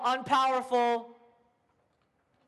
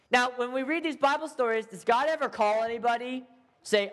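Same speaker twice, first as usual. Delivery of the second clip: ordinary talk, nothing marked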